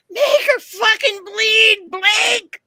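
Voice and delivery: high, slow screaming cheer; shriek. Retold or imitated. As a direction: shriek it at the cage match. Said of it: "you can hear me shriek this".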